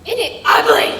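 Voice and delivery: loud, high cry of delight; gleeful shout. Acted. Gran shouting to her grandson about the baby, gleeful. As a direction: belt it out with glee.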